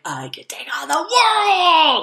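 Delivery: high, loud and slow, like a hero rallying; triumphant battle cry. Retold or imitated. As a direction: proclaim it big and hammed-up. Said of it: "I'm like".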